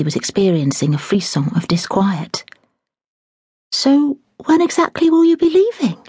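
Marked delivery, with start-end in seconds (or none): 3.7-6.0 lightly voiced eager maid, higher; a hint of a curious young girl; young maid pressing the housekeeper for news. Daisy voiced in reading.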